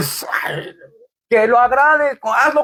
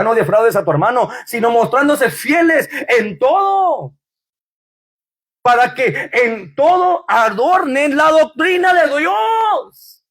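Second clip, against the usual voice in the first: preaching; 3 semitones higher, slower than usual. Acted. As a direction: preach it, build to the final Dios. Reads scripture building to shout. (predicando el versículo con fuerza)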